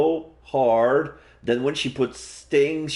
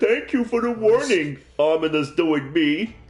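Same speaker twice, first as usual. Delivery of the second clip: raised, polite thanks; polite boy; 11 semitones higher than usual. Acted. Satoshi thanking the ghost for the warning, raised voice.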